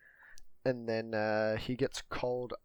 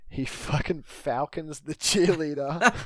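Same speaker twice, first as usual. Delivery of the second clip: loud, high, slow laughing speech; cracking up; 8 semitones higher, 11 dB louder, slower than usual. Real, not acted. Retelling the cheerleader getting hit, cracking up loudly. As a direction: push the words out through real laughter.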